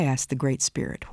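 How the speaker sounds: ordinary talk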